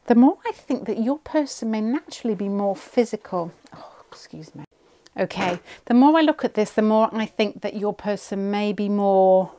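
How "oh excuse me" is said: breathy whisper, under the breath; whispered aside. Real, not acted. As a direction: drop to a whisper to excuse yourself. Quiet aside mid-reading.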